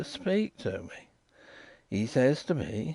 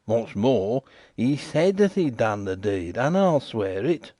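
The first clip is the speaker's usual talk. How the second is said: each phrase beaten out, mild high insistence; slow and emphatic; 7 semitones higher, 3 dB louder than usual. A narrator's reading. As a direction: hammer every phrase like a sworn oath. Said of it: "slowly and emphatically, beating it all out".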